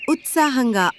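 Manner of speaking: ordinary delivery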